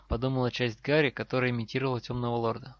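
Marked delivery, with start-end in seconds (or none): none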